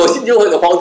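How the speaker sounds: usual speaking voice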